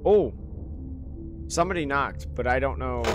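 ordinary talk, nothing marked

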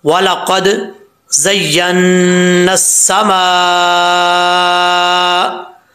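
slow, melodic Quranic chanting with long held vowels; Quran recitation. Acted. Teacher chanting the verses before the lesson, slow melodic recitation. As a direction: recite it slowly, drawing out each vowel.

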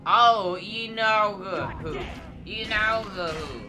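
slow, loud, puzzled repeat of a foreign phrase; strange speech. Acted. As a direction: mimic the odd words slowly. Gnome voices a strange creature.

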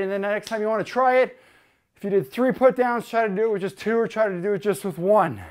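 normal talking voice

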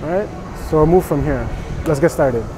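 ordinary talk

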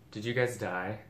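ordinary talk

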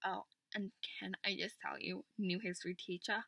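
normal talking voice

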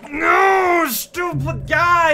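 high, loud shout at the game character; frustrated yell. Real, not acted. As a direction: bark it at the clumsy character.

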